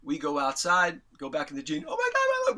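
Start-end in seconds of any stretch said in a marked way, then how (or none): 1.9-2.6 very high, gushing fanboy squeal; squealed mock rapture; imitated fan screaming. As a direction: act out overjoyed fans. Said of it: "We're screaming with how much we loved it".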